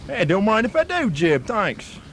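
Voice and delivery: broad put-on Australian bloke spinning a yarn; Australian accent. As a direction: play an outback Australian storyteller.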